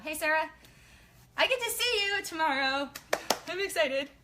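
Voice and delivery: high bright excited hello; squealing with excitement; excited greeting. Real, not acted. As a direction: gush to your friend.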